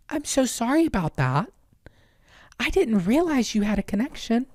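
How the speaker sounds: high, condescending sing-song apology; mock-sweet apology as if to a small child; talking to a child. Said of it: "talk to you like you're a child".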